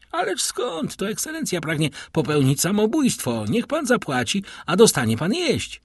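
high-pitched, protesting reassurance; high, courteous objection; polite protest. A narrator's reading. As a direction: pitch it high, as if astonished at the idea. Bandit mockingly protests to hungry captive.

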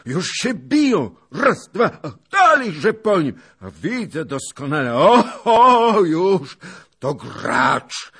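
loud, high running commentary on a fight; thrilled, yelled blow-by-blow; excited calling. A narrator's reading. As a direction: cheer on the fighter in shouts. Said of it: "wołał pan Zagloba".

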